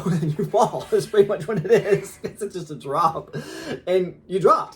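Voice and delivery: real laughter telling of the drop; laughing. Real, not acted. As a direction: laugh through the words. Laughing about the fall.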